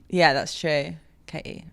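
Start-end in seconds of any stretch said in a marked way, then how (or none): 1.3-1.6 "Katie" with the T left out; dropped T. Shown as a demonstration. Agreeing about own name, soft "Katie" without its T. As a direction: softly say "Katie" with no T sound. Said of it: "we don't say the T".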